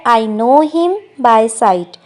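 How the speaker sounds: very slow, careful English model line; slow model sentence. Shown as a demonstration. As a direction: say each word slowly for learners. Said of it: "इसको हम कहेंगे". (धीरे-धीरे साफ़ बोला गया वाक्य)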